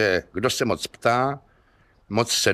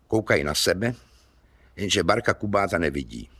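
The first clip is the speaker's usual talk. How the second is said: plain reading voice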